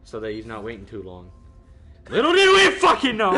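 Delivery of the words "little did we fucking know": high, very loud storyteller's outburst; exasperated exclamation. Real, not acted. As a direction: burst out loudly at the bitter irony.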